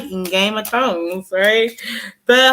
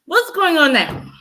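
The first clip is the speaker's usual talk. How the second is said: higher mock taunt; mocking question; 8 semitones higher than usual. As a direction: taunt the doubters. Mocking Trump supporters.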